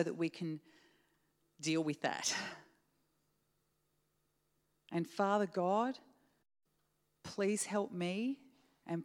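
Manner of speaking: hushed closing prayer before the message. Acted.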